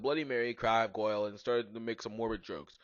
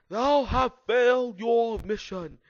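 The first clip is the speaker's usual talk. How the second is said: high, slow, loud rasp; evil raspy villain; 10 semitones higher, 8 dB louder, much slower than usual. A narrator's reading. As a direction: proclaim doom in a screechy villain voice. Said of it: "in his evilly raspy voice".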